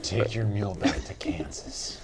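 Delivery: whispered aside. Acted. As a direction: breathe it as a joke.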